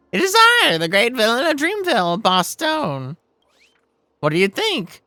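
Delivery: loud, high, boastful villain; cartoon villain. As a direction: ham it up as a cartoon boss. Villain Boss Stone speaks.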